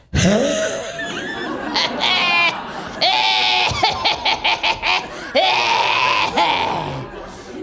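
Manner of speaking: long high wheezing smoker's cackle; trailer park nightmare laugh. Shown as a demonstration. Comic demonstrating mom's laugh, long wheezy cackle. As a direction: cackle like a mom after a Marlboro drag. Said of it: "does that Trailer Park Nightmare laugh".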